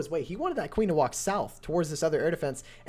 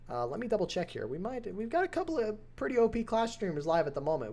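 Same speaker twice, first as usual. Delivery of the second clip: ordinary delivery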